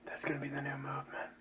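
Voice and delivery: gasp, then a quick whisper; hushed mock awe. Host predicting a rebus craze, hushed mock awe. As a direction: whisper it like a playful revelation.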